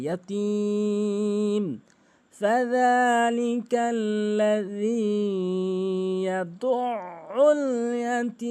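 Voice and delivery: slow, melodic chant with long held endings; Quran recitation. Shown as a demonstration. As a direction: recite stretching every long vowel. Recites the verse. (ধীরে টেনে তিলাওয়াত)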